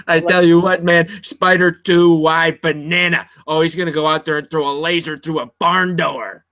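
terrible, hammed-up coach impression; Jon Gruden. Co-host mimicking a coach for laughs, hammed-up impression. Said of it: "That's my terrible impression of Jon Gruden".

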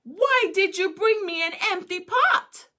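put-on the emperor challenging the boy; emperor's stern question. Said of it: "emperor asked him".

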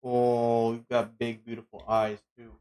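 normal talking voice

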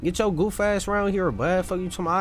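ordinary delivery

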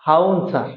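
হাউন স্যার said with no r sound, haun sar; mock-British হাউন স্যার, drop the r in Harun; British-style drop of r. Shown as a demonstration. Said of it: "ব্রিটিশদের মতো উচ্চারণ করলে হবে হাউন স্যার".

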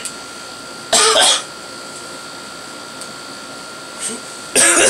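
long, strained cough from the spicy heat; choking cough. Real, not acted. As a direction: cough hard like something went down wrong. After hot sauce, choking cough.

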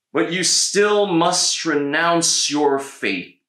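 slow, loud high demand of a tyrant; stern governor. A narrator's reading. Agricola commanding Blaze, slow loud stern demand. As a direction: lay down the law to the prisoner. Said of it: "he told Blaze".

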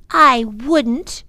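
a little sister defying her brother; defiant. A narrator's reading. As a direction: stand your ground. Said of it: "Lindy raised her chin defiantly".

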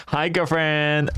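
long, lilting greeting; drawn-out greeting. Pretend. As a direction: draw out the last word playfully. Final take of requested greeting.

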